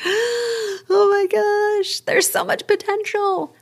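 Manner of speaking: high, slow, gasping awe; awed excitement. Retold or imitated. Host imitating her own thrilled reaction, high and slow. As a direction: stretch it out in breathless wonder. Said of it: "I'm like".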